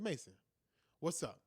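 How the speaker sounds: calm dad to upset son. Retold or imitated. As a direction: ease into the room calmly. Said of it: "in a calm voice".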